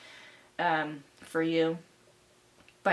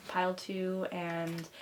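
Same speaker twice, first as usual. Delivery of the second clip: ordinary delivery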